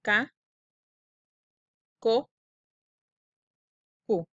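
"K, ko, ku" each said apart with a pause; k syllables. Shown as a demonstration. Teacher modelling syllables for kids to repeat. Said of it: "Repitan conmigo".

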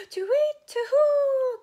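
very high, loud, drawn-out hoot; panicked owl hoot. Acted. Owl fleeing the Gruffalo, panicked hooting. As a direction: hoot like a startled storybook owl.

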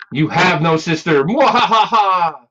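slow, booming evil laugh; slow theatrical villain menace. Acted. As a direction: gloat like a pantomime villain.

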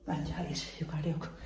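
soft searching mutter; quiet muttering. Real, not acted. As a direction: say it under your breath.

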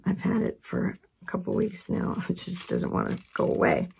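ordinary delivery

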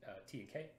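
ordinary talk, nothing marked

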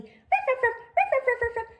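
high, playful little dog barks; puppy barking. Acted. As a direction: bark like an excited puppy. Millie the dog puppet barks.

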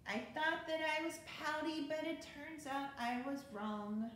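lively storybook read-aloud; bouncy rhyming picture-book narration; reading to children. A narrator's reading. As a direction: read it like bedtime story time for kids.

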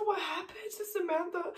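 high, softly tearful voice; tearful retold question. Husband's mom asking what happened to Samantha, retold tearfully. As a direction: ask it while fighting back real tears.

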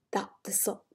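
breathy unvoiced whisper. Mid-story to the child, a whispered slip. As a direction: drop to a whisper.

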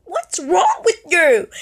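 high, slow, incredulous question. Retold or imitated. Girl at a party asks the staring man, slow and disbelieving. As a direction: pull back at the weird stare, slowly.

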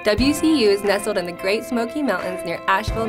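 usual speaking voice

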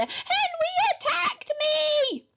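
very high, slow tattling wail; drawn-out babyish howl; screaming little brother. A narrator's reading. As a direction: shriek the accusation like a small child. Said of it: "screamed Perfect Peter".

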